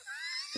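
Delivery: squeaky high giggle; high laugh. Real, not acted. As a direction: giggle at your own joke. Laughing at own story.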